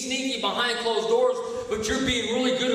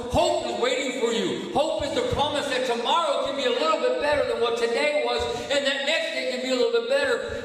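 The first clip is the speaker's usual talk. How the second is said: higher, driving preacher voice; full-force preaching; 4 semitones higher than usual. Acted. Preacher rousing the recovery meeting, driving and high. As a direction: lift the room, keep pushing.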